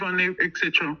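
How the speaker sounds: normal talking voice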